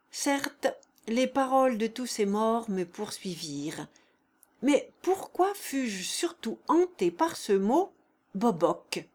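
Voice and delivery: usual speaking voice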